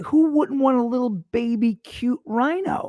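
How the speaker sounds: put-on high, slow, cooing baby talk; cutesy baby voice. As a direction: coo it like over a puppy. Gushing over a tiny rhino.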